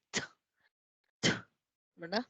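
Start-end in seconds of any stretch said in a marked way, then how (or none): 0.1-1.3 "T" as a bare burst of air; isolated English "t", released on the breath; aspirated T. Shown as a demonstration. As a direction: puff out "t" with no vowel. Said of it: "tiramos aire".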